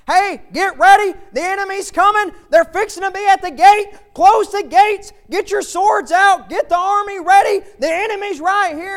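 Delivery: urgent full-voiced watchman's holler; hollered alarm. Watchman hollers down from the tower to warn the city, shouted alarm. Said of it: "he hollers down".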